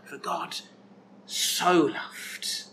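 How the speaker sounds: soft whispered echo of the verse. Shown as a demonstration. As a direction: breathe the words out as a hushed whisper. Preacher quotes verse, then whispers it.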